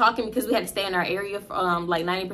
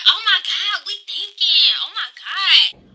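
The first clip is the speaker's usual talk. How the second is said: high, exasperated mock sigh, slowing at end; real dramatic; 7 semitones higher, 4 dB louder than usual. Drive-through customer sighs over the speaker, high mock drama. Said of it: "Real dramatic".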